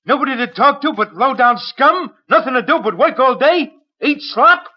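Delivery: rising, scornful tirade about prison; fast, bitter rant, pitch climbing; bitter outburst. Acted.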